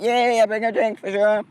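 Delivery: high, bubbly yes; eager agreement. Retold or imitated. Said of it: "And I'm like".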